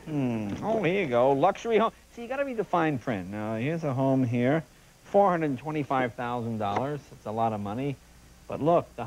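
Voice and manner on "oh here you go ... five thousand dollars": ordinary talk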